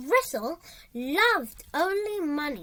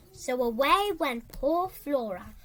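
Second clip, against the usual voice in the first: ordinary delivery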